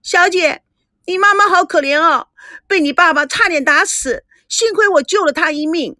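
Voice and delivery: high-pitched tattling cry; calling out loudly. A narrator's reading. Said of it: "秋妹在旁边大声地叫着". (在旁边高声叫着告状)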